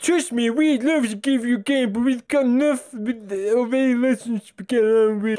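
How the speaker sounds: high, silly character voice; put-on schoolboy dialogue voice. Schoolkids arguing over a kickabout, high put-on voice. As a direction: pitch it up like a hammed-up game character.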